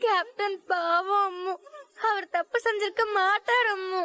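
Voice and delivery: pleading through sobs; child sobbing to mother about Captain; crying child. Acted. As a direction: cry while defending him.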